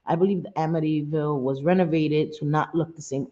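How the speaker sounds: ordinary talk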